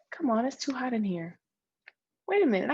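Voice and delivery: ordinary talk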